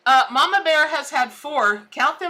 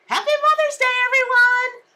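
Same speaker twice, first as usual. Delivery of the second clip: much higher, bright loud wish; excited greeting; 12 semitones higher, 5 dB louder than usual. Real, not acted. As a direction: call out the holiday wish with joy. Greeting viewers on Mother's Day.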